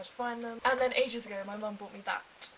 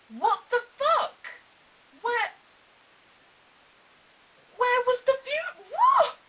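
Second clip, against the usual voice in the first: very high, loud incredulous shout; outraged shout; 13 semitones higher, 14 dB louder, slower than usual. Retold or imitated. Fan recalling the cut to the tower, high outraged shout. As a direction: yell it in stunned disbelief.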